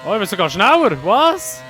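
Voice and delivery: Stimme schießt ungläubig hoch; laut und hoch staunen; ungläubig überrascht. Real, not acted. Sees he is faster. (überrascht und ungläubig)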